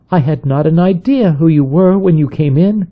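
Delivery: lightly voiced wife explaining; wife's voice. A narrator's reading. Wife speaks to Kate.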